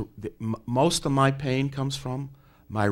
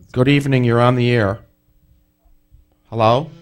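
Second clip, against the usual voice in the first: loud, clear host voice for a bad line; to someone hard of hearing; 3 semitones higher, 11 dB louder than usual. Real, not acted. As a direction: call out on air so the caller hears. Host calling out to silent line.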